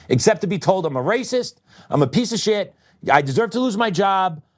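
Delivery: high, heated complaint, voice pushed up; angry rant. Real, not acted. Venting to viewers about taxes, high heated rant. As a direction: let the frustration spill over, pitched high.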